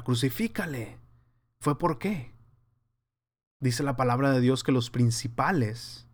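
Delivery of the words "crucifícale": usual speaking voice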